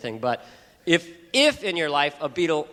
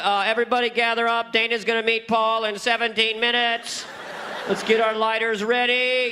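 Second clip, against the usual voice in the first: high, sustained calling-out voice; hammed-up crier gathering a crowd; rallying announcement; 8 semitones higher, 4 dB louder than usual. Friends summon everyone to party, high rallying call.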